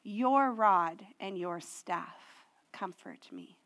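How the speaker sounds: slow, drawn-out "You are with me"; intimate address. Acted. Pastor voicing David speaking to God, slow and intimate. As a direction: speak it as if directly to God, slowly. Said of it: "he now says".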